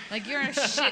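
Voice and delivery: laughing insult. Real, not acted.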